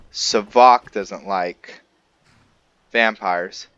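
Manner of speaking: ordinary talk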